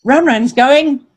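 ordinary delivery, nothing marked

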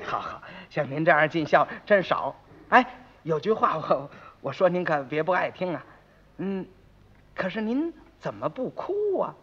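soft, low careful questioning; hesitant, tactful. Acted. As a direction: edge gingerly towards an awkward question. 街坊怕得罪人，小心发问. (轻声试探、小心翼翼)

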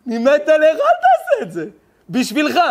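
mock emotional plea from the community; pleading warning. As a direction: beg him like a worried friend. Voicing people's loving warning.

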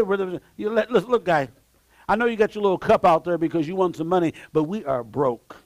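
ordinary talk

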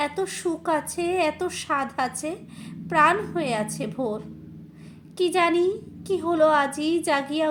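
slow, loud, impassioned Bengali poetry recital; declaimed verse in measured, ringing phrases; poem recitation. Acted. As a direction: recite it with swelling fervour, drawing the words out. Recites a poem, then chats.

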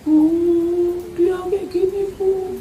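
put-on high, soft young woman complaining; daughter-in-law voice. Daughter-in-law complains to her mother-in-law, high and soft. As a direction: tell on him quietly in a high young voice.

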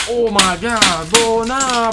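ordinary talk

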